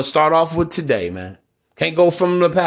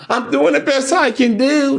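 mock woman voice; 9 semitones higher than usual. Mocking a woman's plea.

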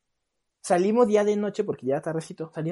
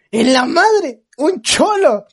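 higher, louder panicked shout; scared yell; 7 semitones higher, 8 dB louder, much slower than usual. Retold or imitated. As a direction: yell in fright at the thug. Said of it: "pero gritando".

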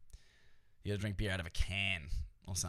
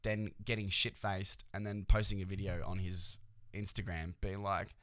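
ordinary talk, nothing marked

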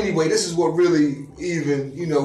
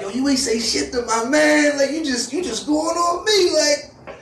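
high, loud heated comeback, laughing by the end; mock angry retort; 12 semitones higher, 4 dB louder, slower than usual. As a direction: throw it back at him, rising. Driver angrily retorts.

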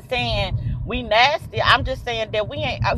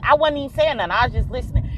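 ordinary talk

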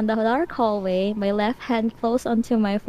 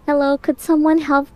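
calling out; 6 semitones higher than usual. A narrator's reading. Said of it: "I called out".

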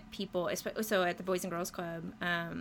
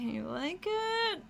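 high, drawn-out hopeful plea with a laugh; anxious plea; 10 semitones higher than usual. Retold or imitated. Artist pitching a concept into silence, anxious plea. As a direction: stretch it upward, half laughing at yourself. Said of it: "wading through the crickets".

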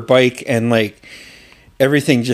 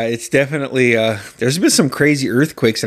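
usual speaking voice

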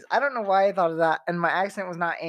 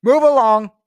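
louder, firmer Jedi command; firm louder Move along; 3 semitones higher, 11 dB louder than usual. Pretend. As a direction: push it out like a mind trick. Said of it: "what does he say?".

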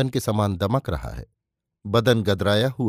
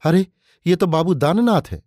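higher-pitched cry of startled recognition; shocked exclamation; 7 semitones higher, 4 dB louder than usual. A narrator's reading. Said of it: "प्राणनाथ ने चिल्लाकर कहा".

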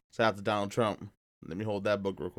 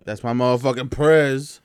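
loud hype; 4 semitones higher, 12 dB louder than usual. Pretend. Hyping up Trump cameo.

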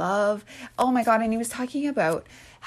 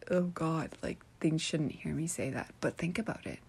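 soft, lowered aside; guarded, confiding undertone; hushed; 3 semitones lower, 9 dB quieter than usual. Real, not acted. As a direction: keep it down so nobody overhears. Fears being overheard saying it.

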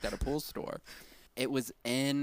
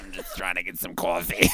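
put-on lower, heavy Northeast accent; New Yorker accent; 5 semitones lower, 7 dB louder than usual. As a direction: play a tough Brooklyn guy in line for coffee. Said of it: "you sound like you're from the Northeast".

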